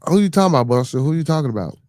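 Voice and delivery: high and loud, a sharp who-are-you-talking-about; incredulous demand. Real, not acted. Streamer to chat commenter Buster, incredulous loud challenge.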